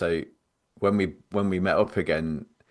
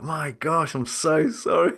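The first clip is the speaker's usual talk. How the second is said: shocked apology; 8 semitones higher than usual. Retold or imitated. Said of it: "I was so shocked".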